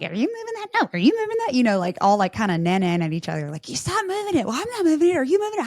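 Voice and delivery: ordinary talk, nothing marked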